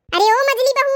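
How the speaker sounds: loud summoning call across the house; sharp loud call to the sleeping bahu. Acted. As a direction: yell for the daughter-in-law to wake. Mother-in-law calls sleeping daughters-in-law.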